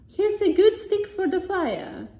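high, loud other voice; character voice. A narrator's reading. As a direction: voice a cheery stranger. A different voice speaks.

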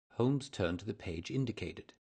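ordinary delivery, nothing marked

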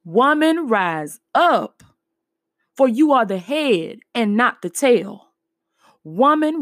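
usual speaking voice

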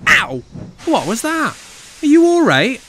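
very high, loud yelp of alarm; shocked, high-pitched outburst; startled. A narrator's reading. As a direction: cry out in sudden pain and alarm. Startled cry, truck is hit.

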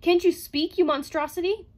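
loud, high, exasperated demand; blurted outburst. A narrator's reading. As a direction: berate the mute robot. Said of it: "blurted Bogart".

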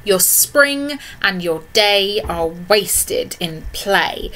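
slow, deliberate reading of the poem's line. Shown as a demonstration. Teacher reading the nurse's line aloud, slow and deliberate. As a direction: draw out the nurse's line word by word. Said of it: "when she says".

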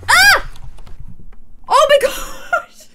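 high, loud frightened cries; terrified. Real, not acted. Streamer startled by a scary noise, frightened cries. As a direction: shriek at the noise.

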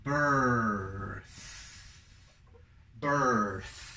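"birth" with long er and trailing th; pronunciation of birth. Shown as a demonstration. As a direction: draw out "birth" into the th. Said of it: "Quedaría". ("birth" con th final, como Z española)

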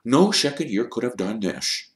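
firm. A narrator's reading. Dumbledore defending Harry against the accusation, firm. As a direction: rule it out firmly. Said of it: "said Dumbledore firmly".

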